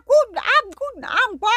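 high clucking hen voice. Acted. The hens greet the guest in high clucking voices. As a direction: chirp like a sleepy hen.

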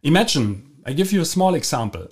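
ordinary delivery

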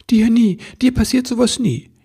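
high, loud little-elf voice; protesting elf. Acted. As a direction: protest in self-reproach. Elf protests to reassuring Santa.

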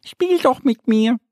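high, bright little duck Annie. Acted. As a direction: play the small duck, pitched well up. Ente bittet Bär zu spielen. (Annie weckt Mo mit hoher Entenstimme)

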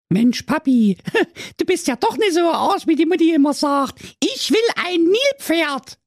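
put-on high, gushing girl voice; excited daughter. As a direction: squeal your thanks to dad. Daughter rejoices.

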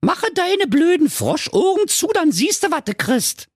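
very high, dismissive princess; snippy high-pitched diva; Carola Knackwurst. Acted. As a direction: brush the frog off in a piercingly high voice. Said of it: "die schöne Carola sprach".

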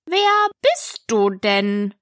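slow, scornful drawl; contemptuous caterpillar, words dragged. A narrator's reading. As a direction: stretch the question out disdainfully. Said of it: "sagte die Raupe verächtlich".

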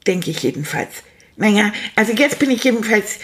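usual speaking voice

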